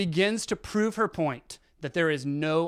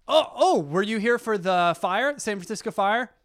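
higher and louder, excited; doctor suddenly excited about the fire, higher; eager doctor; 5 semitones higher, 5 dB louder than usual. Retold or imitated. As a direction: jump on a possible story.

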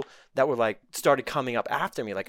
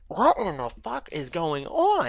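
disbelief; 6 semitones higher, slower than usual. Retold or imitated. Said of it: "And I'm like".

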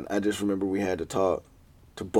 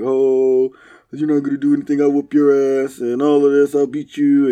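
a hint of a blustering kid, raised and loud; mock bragging bully; 5 semitones higher, 7 dB louder than usual. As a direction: puff up like a boastful kid. Sausage mocked, bragging.

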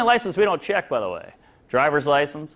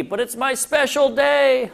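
slow, drawn-out bride whine; mock whiny bride; 7 semitones higher, slower than usual. Mocking ladies, a slow whiny bride's plea. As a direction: drag it out like a pouty bride.

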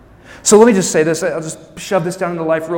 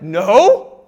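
mock refusal; 2 semitones higher, 10 dB louder than usual. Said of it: "it's like".

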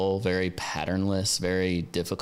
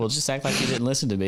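laughing while speaking; 6 semitones higher, faster than usual. Real, not acted. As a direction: talk through a laugh. Laughing at the bad audio.

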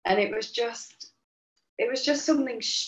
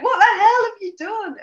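mock incredulous, loud and high; playful impression of Roy's shock; imitating husband; 12 semitones higher, 10 dB louder than usual. Retelling husband's reaction to the oily hands, mock outraged. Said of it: "and he's like".

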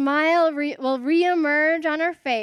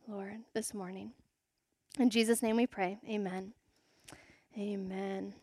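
soft, lower praying voice; prayer; 4 semitones lower, 8 dB quieter than usual. Real, not acted. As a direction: lead a hushed prayer to God, quietly. Closing spoken prayer.

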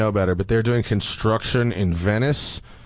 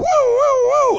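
high loud woo siren, much higher and louder; ambulance siren imitation; 5 dB louder than usual. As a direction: wail like a passing ambulance. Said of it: "ambulance flies by".

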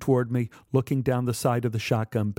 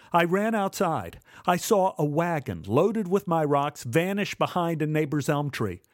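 plain reading voice

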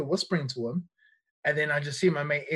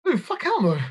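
shocked refusal; 12 semitones higher, 4 dB louder than usual. Retold or imitated. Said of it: "And I was just like".

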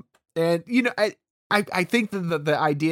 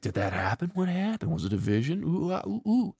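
spooked vision; 4 semitones higher, 6 dB quieter than usual. Retold or imitated. Mock vision after the cut.

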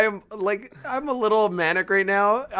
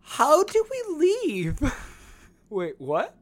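put-on drunk girl voice; 9 semitones higher, slower than usual. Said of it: "and go".

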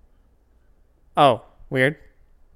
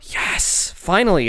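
excited gasp; 11 semitones higher than usual. Real, not acted. Closed captioning celebration.

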